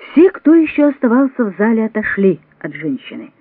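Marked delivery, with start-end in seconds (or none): none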